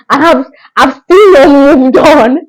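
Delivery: choked up for real; real tearful. Real, not acted. Still hurting over the loss.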